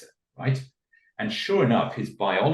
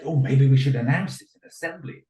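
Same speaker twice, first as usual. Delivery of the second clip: high, raised-voice eager suggestion; playing along; 7 semitones higher, 6 dB louder than usual. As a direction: act a teacher joining in the praise. Teacher joins in the staged praise.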